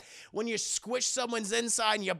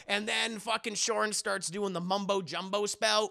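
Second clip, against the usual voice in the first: plain reading voice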